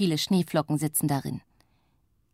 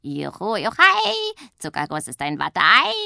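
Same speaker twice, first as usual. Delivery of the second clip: gleeful taunt; 7 dB louder than usual. A narrator's reading. Witch races downhill taunting her sister, jubilant sing-song. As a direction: crow with glee. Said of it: "ruft sie Zuckerguss zu".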